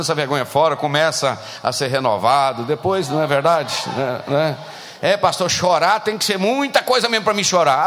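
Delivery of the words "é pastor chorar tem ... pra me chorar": mock grandma. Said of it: "old lady voiced".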